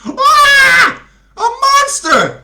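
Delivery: very high, loud panicked shriek; terrified scream. Acted. Director screaming in terror at the monster. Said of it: "shouted Peter".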